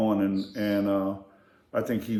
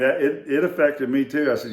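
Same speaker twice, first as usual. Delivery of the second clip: ordinary delivery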